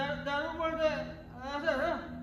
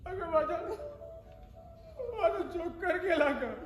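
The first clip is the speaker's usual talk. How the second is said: crying. Acted. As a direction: voice breaking into a sob. Man breaks down over lost work.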